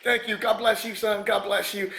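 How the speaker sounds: high imitated voice of thanks; Mister T thanking. Star hugging a fan, high imitated thanks. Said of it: "It was like".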